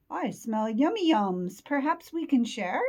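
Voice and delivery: lightly voiced, eager badger; hopeful badger. Badger asks the others to share a snack, hopeful.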